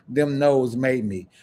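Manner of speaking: normal talking voice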